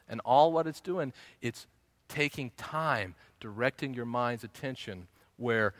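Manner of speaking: normal talking voice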